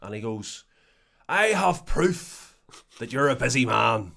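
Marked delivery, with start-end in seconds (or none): 1.3-4.1 put-on old man at the bus stop. Old man's odd remark, retold.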